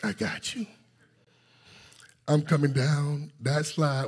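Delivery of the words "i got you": a high, soft "I got you" from the friend; gentle, lifted-up reassurance; friend's reassurance. Retold or imitated. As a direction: voice the friend calmly promising to catch him. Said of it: "cause he said".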